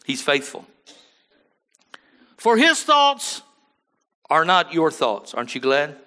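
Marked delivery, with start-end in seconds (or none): 2.4-5.3 high, loud, slow proclaiming of the verse; preaching scripture. Acted. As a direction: ring the scripture out to the church. Preacher quotes scripture loudly.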